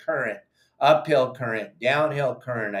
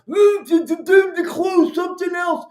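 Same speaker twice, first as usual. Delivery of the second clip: shrill, much higher, loud mocking squeal; mocking moron voice; 14 semitones higher, 7 dB louder than usual. Mocking the pro-closure anglers in a high squeaky voice. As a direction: sneer at anglers who want closures by squealing their line. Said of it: "that are like".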